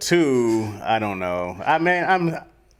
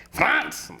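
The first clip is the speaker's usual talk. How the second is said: high-pitched mock German accent; 12 semitones higher than usual. Shown as a demonstration. Showing co-host the name, mock German accent. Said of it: "I was thinking of a German".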